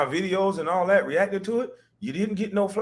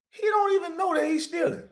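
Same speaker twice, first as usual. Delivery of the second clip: mocking. Repeated mocking the thief.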